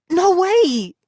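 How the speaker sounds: high, loud denial; crying out. A narrator's reading. Brother denying he broke the doll, crying out. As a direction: cry out in protest. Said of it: "Ian cried".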